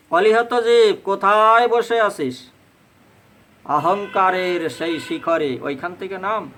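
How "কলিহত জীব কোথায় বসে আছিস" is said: loud, higher call to the lost souls. Acted. Mahaprabhu calls to the souls of Kali, loud and pleading. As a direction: cry out to them from far above.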